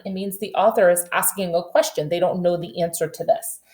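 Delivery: usual speaking voice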